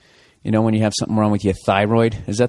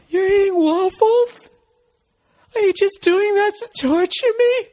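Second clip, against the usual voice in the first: put-on high, slow accusing girlfriend voice; mock girlfriend. Dieting girlfriend accusing him over waffles, high whiny mock. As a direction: whine it like a hungry dieting girlfriend.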